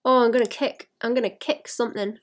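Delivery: normal talking voice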